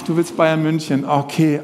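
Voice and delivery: normal talking voice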